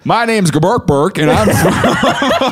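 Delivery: high, laughing fake Southern accent; mock Southern accent. Alien introducing itself to locals, hammed-up Southern accent. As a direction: introduce yourself in a silly Southern twang. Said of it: "they're just doing the accent of wherever they end up".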